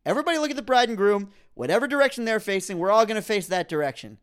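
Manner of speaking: hammed-up order to line up and face the couple; mock bossy instructions. Rallying the wedding guests, fast bossy shout. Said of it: "Like, come on".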